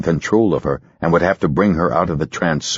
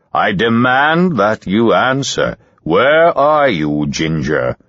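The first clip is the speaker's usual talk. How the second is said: louder, slow, hard command; stern demand; 4 semitones higher, 4 dB louder, slower than usual. Acted. Hypnotist demanding, loud and stern. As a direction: insist on an answer. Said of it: "He became very stern".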